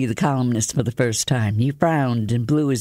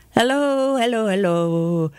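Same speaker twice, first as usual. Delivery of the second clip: a bright, much higher tenor greeting; 8 semitones higher than usual. A narrator's reading. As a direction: call hello up high and pleased. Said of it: "It came in a high tenor".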